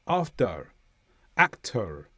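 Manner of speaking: "After", "actor" with an American hint of r at the end; American-style final r. Shown as a demonstration. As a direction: curl into a light American r ending "After", "actor". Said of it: "there will be a hint of a r".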